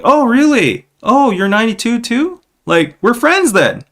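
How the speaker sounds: high, eager mock surprise; excited Korean friend. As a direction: act out a delighted new friend. Koreans acting out meeting.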